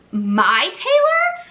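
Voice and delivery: high, loud, slow "My Taylor?"; excited friend realizing who the other winner is; delighted surprise. Retold or imitated. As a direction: stretch it out in happy disbelief. Recognizing her friend as the winner.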